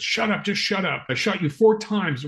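high, agitated shout-like quote; freaking out. Retold or imitated. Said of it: "he kept freaking out".

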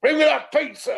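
high, loud mock demand; demanding shout. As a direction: bellow for the pizza, hammed up.

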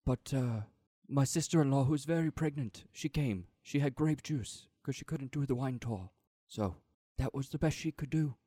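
put-on earnest hobbit gardener accent; Sam's Shire accent. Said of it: "I'm doing, uh, Frodo's friend, Sam".

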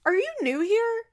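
high mocking disbelief; sarcastic jab. Host mocking Janelle's cluelessness, high sarcastic. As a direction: tease her like she's clueless. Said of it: "And it's like".

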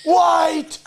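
high mock postman chasing her with parcels; postman impression. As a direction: call after her like a cheery postman. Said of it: "and he goes".

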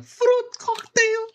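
very high and slow, mocking; squeaky high voice. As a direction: squeak it out like a cartoon. Mocking the Oros flavor.

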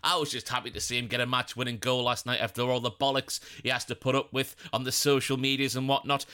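usual speaking voice

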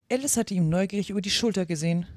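plain reading voice

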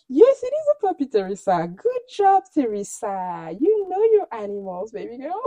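high, sing-song congratulating; teasingly patronizing, high-pitched coo; mock baby praise. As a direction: praise her as if she were a toddler. Mock praising Teresa like a toddler.

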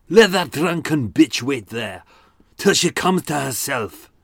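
Alexander Moyer. Acted. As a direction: threaten in a harsh Glaswegian accent, building in volume. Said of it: "this is my Alexander Moyer impression".

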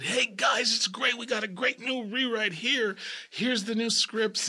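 lightly mocking, high, upbeat producer voice; chirpy producers. Producers hand the cast a rewrite, mock cheerful pitch. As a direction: sell the rewrite with fake cheer. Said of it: "they came in and said".

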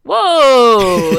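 shocked whoa. Real, not acted. Reacts to women stabbing catcallers.

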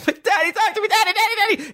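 put-on high-pitched frantic child-like begging; panicked pleading. Dreamer begging his father to face him, panicked pleading. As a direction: plead for Daddy in a squeal, hammed-up.

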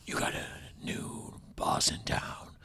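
raspy whispered Batman growl. Retold or imitated. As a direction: rasp it out like Batman. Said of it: "he was just like".

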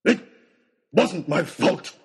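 shouted. A narrator's reading. Oxshott denies blame to Nicholas, shouting. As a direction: shout your denial in hard bursts. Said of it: "shouted Oxshott".

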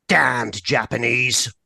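ordinary talk, nothing marked

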